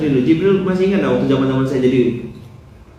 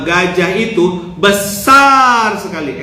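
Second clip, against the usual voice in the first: BESAR lifted high and stretched hugely; big expressive swell on BESAR before "sekali"; expressive demo; 3 semitones higher, slower than usual. Shown as a demonstration. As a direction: make "besar" enormous, held long and high. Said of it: "Sekarang ekspresi wajah".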